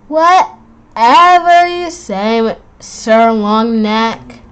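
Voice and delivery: slow, drawn-out mock deference; sarcastic. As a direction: sarcastically drag it out. Mocking the teacher.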